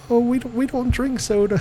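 put-on high, prim little-girl voice; daughters' voice. Dad imitating his daughters politely turning down soda, high girl voice. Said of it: "They're like".

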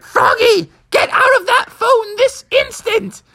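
very high-pitched mock mom shriek; hammed-up high-pitched scolding. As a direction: screech it like a cartoon mother ordering a child. Mom scolding Froggy in the story.

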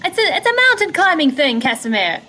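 high, projected acted call; calling over wind. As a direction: explain it over a howling storm. In character, explaining over the wind.

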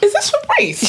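high-pitched retold phone query; quoting own greeting. Friend retelling her call to colleague, high-pitched query. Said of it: "I said".

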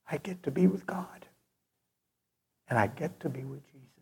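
quiet, reverent murmur; awed whisper. Real, not acted. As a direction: hush it as if heaven is close. Preacher hushes in awe.

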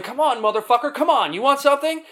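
high-pitched mock challenge to a fight; imitated yelling. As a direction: imitate an aggressive man yelling a dare.

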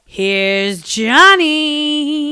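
a hammed-up creepy Here's Johnny; Here's Johnny impression. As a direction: stretch it out like the horror film. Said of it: "it was full on".

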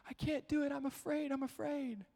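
put-on high, small scared kid voice; little girl voice. Dad imitating his scared little daughter, high voice. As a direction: squeak it like a timid toddler. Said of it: "she's like".

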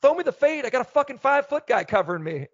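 pumped-up receiver begging for the ball; hyped up. Retold or imitated. Player hyped during a timeout pleads with his coach for the fade. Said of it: "I'm all hyped up".

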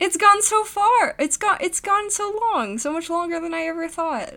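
high-pitched astonished complaint; amazed disbelief. Real, not acted. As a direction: marvel at it, voice pushed way up. Amazed at how long it ran.

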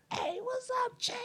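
squeaky high, held-back shout; excited greeting. Retold or imitated. Naked guy happy to see his friend, excited greeting. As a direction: imitate an excited hello in a thin high voice. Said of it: "he got kinda loud 'cause he got a little excited".

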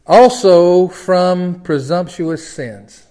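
solemn stretched pulpit line; slow proclamation. Acted. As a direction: draw out each word. Drawn-out scripture reading.

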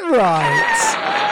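shoots way up high on a stretched right; thrilled announcer pitch leap; excited squeal. Real, not acted. Host revealing the winner, squealing with excitement.

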